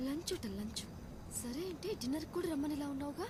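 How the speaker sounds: grumbling mockery. Woman mocking the neighbour's lunch invitation, grumbling. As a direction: grumble it under your breath. Mutters jealously after the neighbour leaves.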